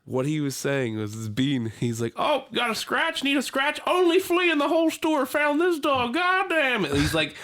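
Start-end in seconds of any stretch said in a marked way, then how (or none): 2.2-6.9 old man impression. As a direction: ham up the old man fussing over the dog. Old man's flea story retold.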